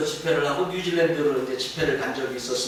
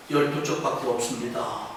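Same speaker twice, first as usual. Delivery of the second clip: despairing version; 6 semitones lower, 6 dB quieter than usual. Shown as a demonstration. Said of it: "이 말을 바꿔서".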